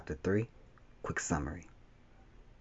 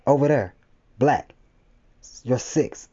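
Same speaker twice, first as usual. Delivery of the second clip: urgent raised-voice warning, higher and louder; loud scream; 6 semitones higher, 5 dB louder than usual. A narrator's reading. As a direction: call out a warning in narrated alarm. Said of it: "screamed in a loud voice".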